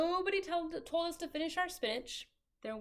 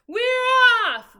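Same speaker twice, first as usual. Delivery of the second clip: very loud, high cheer; crew shout; 11 semitones higher, 16 dB louder than usual. A narrator's reading. Crew shouts together as they set sail. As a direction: shout it out with everyone. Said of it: "we all shouted".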